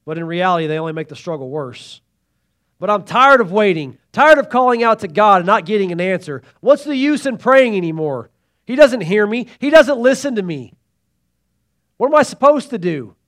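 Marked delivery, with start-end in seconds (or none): 2.8-13.1 louder, higher frustrated complaint. Pretend. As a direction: vent your frustration at God. Voicing frustrated believer.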